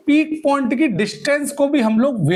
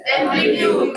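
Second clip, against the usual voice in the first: whispered echo. Shown as a demonstration. Teacher whispering the term amplitude back to the class. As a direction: breathe amplitude out with no voice. Word echoed right after the question.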